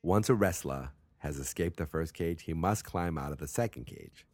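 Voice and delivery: put-on Boston accent, dropped r's. Shown as a demonstration. Host explains how to win, Boston accent. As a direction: read the rules like a Bostonian. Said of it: "talk with a real Boston accent".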